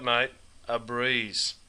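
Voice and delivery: drawn-out "a breeze"; slow. Shown as a demonstration. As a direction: let "a breeze" hang, very slowly. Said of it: "That's right".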